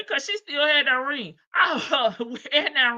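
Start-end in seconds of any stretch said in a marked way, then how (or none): none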